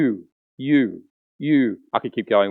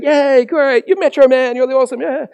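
crowd cheering; 10 semitones higher, 3 dB louder than usual. Retold or imitated.

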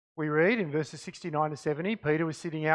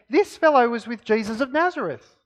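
raised, louder accusing voice; accusation; 6 semitones higher, 6 dB louder than usual. A narrator's reading. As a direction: read it out as a public accusation. Reads servant girl's accusation aloud.

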